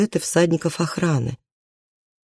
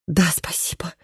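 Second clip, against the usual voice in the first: breathless whisper; breathed out; 5 dB quieter than usual. Acted. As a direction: gasp it out on the breath. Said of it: "выдохнула Сесилия".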